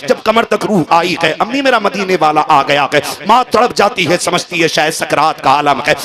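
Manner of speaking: high, loud impassioned sermon climax; preaching at full force. Acted. Preacher to congregation, in a high impassioned cry.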